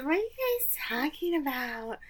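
put-on high, soft childish pipe; little-girl voice. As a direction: play a nosy nine-year-old, small and high. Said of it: "She's dressed like she's nine".

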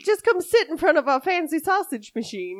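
put-on Southern accent. Joining the Southern Belle bit.

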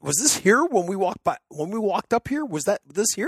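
high, bewildered run of questions; baffled questioning. Retold or imitated. Friend swears the drone came home, high baffled questions. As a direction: search the field with your voice. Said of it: "I'm looking around the field like".

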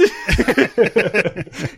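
high-pitched amused chuckle; high laugh. Real, not acted. Laughing at movie blurb.

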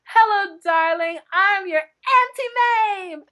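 high, theatrical greeting with the last words drawn out; Auntie Mame. Retold or imitated. Greeting husband and daughter off the plane as Auntie Mame, theatrical. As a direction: proclaim it like a grand movie entrance. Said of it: "raised up my arms and I said".

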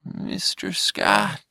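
very slow, voiceless alien murmur; slow whispered alien tenderness; breathy alien caress. Acted. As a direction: whisper his name like a caress. Alien strokes Scott lovingly.

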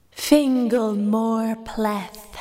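slow, drawn-out summons from a fairy queen; cold regal fairy queen. Acted. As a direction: call his name like an icy queen. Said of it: "The Fey Queen's voice".

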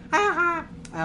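high-pitched cartoon taunt, two sing-out notes; cartoon bully's jeer, pitched high; Simpsons ha-ha voice. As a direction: do the mocking Simpsons-style ha-ha, way up high. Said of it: "the Simpsons ha ha voice".

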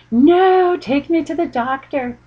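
put-on high, loud childish wail on no; imitating a scared little boy begging; mock whiny child pleading for the doctor; crying kid protest.

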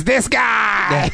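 proud shout. Acted. Punchline, pointing at himself.

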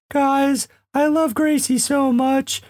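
very high, shouted pet voice; Django's dog voice. Retold or imitated. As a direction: pitch way up for the dog's silly warning. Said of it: "that sort of high pitch became his voice".

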